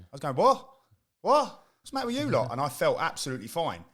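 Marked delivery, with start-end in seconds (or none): 0.3-2.5 high, loud baffled shout; retold dazed footballer yelling at teammates; confused outcry. Retells dazed reaction to teammates.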